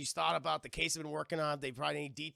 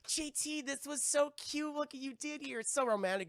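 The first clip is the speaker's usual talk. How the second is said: high-pitched imitation of a delighted girlfriend; mock sweet, higher-pitched voice; gushing girlfriend; 7 semitones higher than usual. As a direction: coo it high, mimicking a smitten woman. Acting out Francesca's gushing quote.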